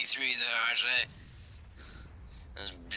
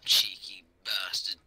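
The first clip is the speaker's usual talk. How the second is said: low, slow, hushed swear; whispered curse; 6 semitones lower than usual. Real, not acted. An enemy tank sneaks in, cursing it in a whisper.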